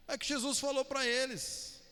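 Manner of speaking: usual speaking voice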